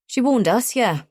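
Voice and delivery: sharp. A narrator's reading. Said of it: "I say sharply".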